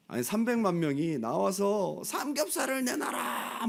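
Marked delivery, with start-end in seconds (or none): 2.0-3.7 mock demanding crowd.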